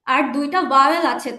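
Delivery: ordinary delivery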